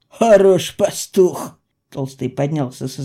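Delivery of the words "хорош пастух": loud, slow, drawn-out jeer; heavy, deliberate sarcasm; sarcastic rebuke. Acted. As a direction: stretch it out in scornful mockery. Mocking the careless goat keeper.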